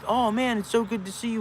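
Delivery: high, gushing greeting voice; high mock-friendly greeting; imitating churchgoer. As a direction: mimic an overly friendly stranger. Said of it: "and they go".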